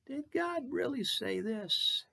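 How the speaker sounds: high, slow, drawn-out sly question; Satan's whisper of doubt. Retold or imitated. Preacher voicing Satan's thought to the congregation, high and slow. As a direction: plant the doubt slowly, like a tempter. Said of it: "Satan has come to you and I multiple times with this thought".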